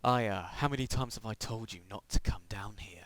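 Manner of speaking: low unvoiced creepy father; creepy whisper. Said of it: "That sounded creepy then".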